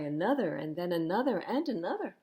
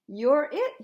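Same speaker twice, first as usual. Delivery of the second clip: higher, much louder tag call; playful shout; 7 semitones higher, 11 dB louder than usual. Acted. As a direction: shout it while tagging someone. Thumper tags Flower.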